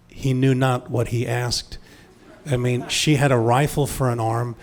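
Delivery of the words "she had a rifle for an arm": usual speaking voice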